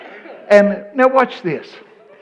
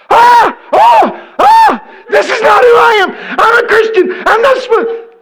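piercing, very high wailing shriek; hysterical meltdown; 8 dB louder than usual. Acted. Girl melting down in the elevator, mock hysterical wailing. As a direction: scream and sob as if falling apart, hammed up. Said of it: "has a meltdown".

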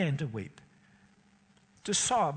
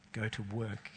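quiet authority; 5 semitones lower, 7 dB quieter than usual. A narrator's reading. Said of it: "Such quiet authority in his voice".

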